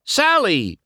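high, loud call across the crowd. A narrator's reading. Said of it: "McQueen called".

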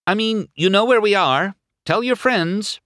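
high, hopeful call into the distance. A narrator's reading. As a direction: call after a departing couple. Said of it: "Sally called as the couple sped off".

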